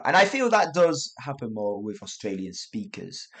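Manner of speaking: ordinary talk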